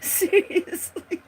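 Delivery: high, slow giggly seriously; laughing, drawn out. Real, not acted. Laughing to the guests about being dizzy. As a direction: stretch it out through a laugh.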